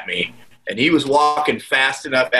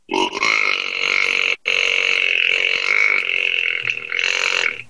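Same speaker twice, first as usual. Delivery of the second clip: long low drawn-out deer grunt; deer grunt imitation. Shown as a demonstration. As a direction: grunt like a buck for seconds. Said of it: "I will imitate this grunt".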